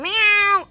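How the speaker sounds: high, loud imitated meow; sudden squealed meow; cat meow. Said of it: "I couldn't find a cat".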